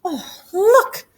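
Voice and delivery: high, eager "look"; sudden high-pitched excitement; excited exclamation. Acted. Missus Ugly spots the invitation.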